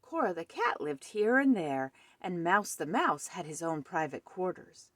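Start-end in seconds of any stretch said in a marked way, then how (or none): none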